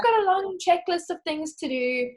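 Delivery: high, whiny, put-upon complaint; whiny complaining. Retold or imitated. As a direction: tattle to Jesus with a long checklist. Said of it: "to Jesus and be like".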